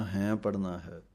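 normal talking voice

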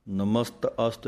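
ਨਮਸਤ ਅਸਤ with the ਅ fully and openly sounded; ਨਮਸਤ ਅਸਤ, every sound spelled out; full open pronunciation. Shown as a demonstration. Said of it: "ਖੁੱਲ੍ਹ ਕੇ ਉਚਾਰਨ, ਪੂਰਾ ਉਚਾਰਨ".

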